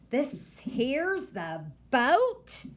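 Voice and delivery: put-on slow, drawn-out old grandma's question; dismayed grandma. A narrator's reading. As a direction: let her doubt sink in slowly.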